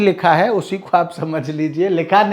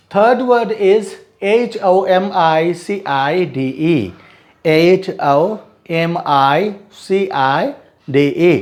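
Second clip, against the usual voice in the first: letters spelled slowly, one by one; slow spelling out; much slower than usual. Shown as a demonstration. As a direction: dictate each letter slowly for the class. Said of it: "मैं सिर्फ spellings पढ़ूंगा".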